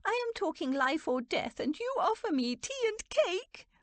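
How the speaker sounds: high, outraged incredulity; appalled, scornful older aunt; loathing. A narrator's reading. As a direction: throw it back in high-pitched disgust. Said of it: "in a voice of loathing".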